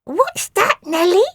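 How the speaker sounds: eager creature curiosity; Phoebe's voice. A narrator's reading. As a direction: Phoebe asking Nellie. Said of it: "said Phoebe".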